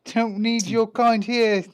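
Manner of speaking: mocking neighbours. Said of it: "They, they'll say".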